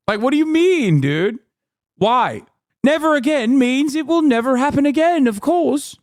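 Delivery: high-pitched sarcastic disbelief; mock naive voice. Streamer mocking those who dismiss Hitler comparisons, high sarcastic voice. Mimics naive believers in never again.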